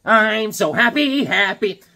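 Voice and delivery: high, loud, stretched sing-song joy; hammed-up happiness. Collector showing off a favorite find, hammed-up high glee. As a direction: gush it out, drawing out each happy. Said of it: "I started getting real happy".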